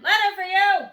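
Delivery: loud, high call; loud shout. A narrator's reading. Postman shouting about a letter. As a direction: holler it.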